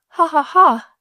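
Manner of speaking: high, loud read-out giggle; giggling. A narrator's reading. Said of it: "she giggled".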